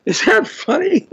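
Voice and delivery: high squeal of laughter breaking through; cracking up at the clip; laughing. Real, not acted. Amused by the Trump parody clip.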